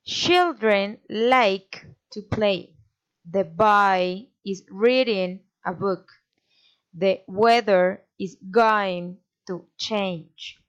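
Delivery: put-on three evenly spaced beats, as in CHILDREN, LIKE, PLAY; slow, measured demo with three stressed words per sentence; three stresses. Shown as a demonstration. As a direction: punch three words, as in BOY, READING, BOOK. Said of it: "A statement with three stresses".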